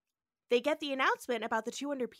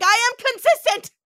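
loud, high hammed-up Kirk outburst; loud, high, slow mock captain's cry; mock shouting Kirk; 8 semitones higher, 14 dB louder, slower than usual. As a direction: bellow it like an over-the-top starship captain. Kirk outburst acted in recap.

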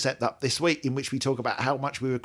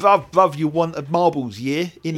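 louder, higher mock London street slang; bad London street accent; 5 semitones higher, 6 dB louder than usual. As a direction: hawk the album like a London street dealer, badly. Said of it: "what's the hell is that accent?".

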